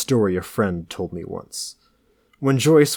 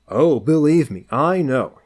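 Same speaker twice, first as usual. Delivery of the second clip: higher, louder knowing smirk; 6 semitones higher, 4 dB louder than usual. A narrator's reading. Alex teasing his friend about George, smirking. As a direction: say it through a smirk. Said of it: "he smirked".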